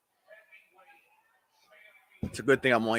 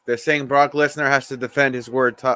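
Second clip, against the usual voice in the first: usual speaking voice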